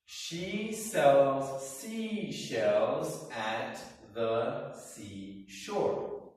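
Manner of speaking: very slow, each sound crisp; very slow, over enunciated. Shown as a demonstration. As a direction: drag out She sells seashells carefully. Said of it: "So the whole sentence".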